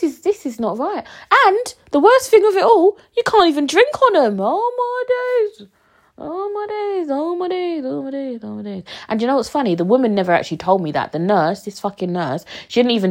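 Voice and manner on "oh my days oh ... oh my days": drawn-out dismayed "Oh, my days", high and sinking; exasperated. Real, not acted. Podcaster despairing over her painkillers, fading sigh-like exclamations.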